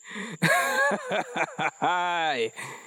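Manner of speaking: a scornful disbelieving laugh; officer's chuckle. A narrator's reading. Officer laughing at the boy's disguise, mocking chuckle. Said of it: "chuckled the officer".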